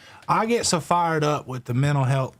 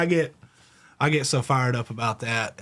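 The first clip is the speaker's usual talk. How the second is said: ordinary talk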